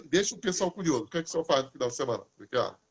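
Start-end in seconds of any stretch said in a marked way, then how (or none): none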